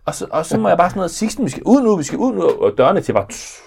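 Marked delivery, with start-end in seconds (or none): 1.1-2.4 urgent urging. Retold or imitated. Father urging son off bus.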